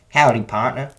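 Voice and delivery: lightly put-on Southern American twang; southern accent. Reviewer imitating an actor in the movie, southern accent. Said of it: "he has a southern accent".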